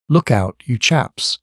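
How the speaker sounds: ordinary delivery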